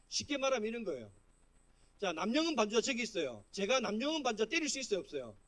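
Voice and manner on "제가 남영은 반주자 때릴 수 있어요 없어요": usual speaking voice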